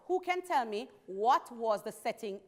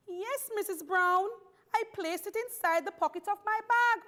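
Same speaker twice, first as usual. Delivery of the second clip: high-pitched insistent schoolgirl; earnest pupil; 10 semitones higher than usual. A narrator's reading. Pupil assuring the teacher about her missing pencil, high and insistent. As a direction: answer the teacher in a raised, emphatic child's voice.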